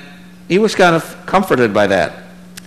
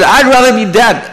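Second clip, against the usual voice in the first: much higher, louder cry of despair; exasperated; 9 semitones higher, 5 dB louder than usual. Acted. Jonah answering God back, higher and louder. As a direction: throw it back at God, bitter. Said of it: "He said".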